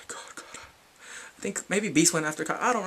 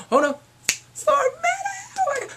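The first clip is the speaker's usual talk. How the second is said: excited squeal; 8 dB louder than usual. Real, not acted. Fan squealing over a favourite idol. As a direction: swoon out loud in a squeal.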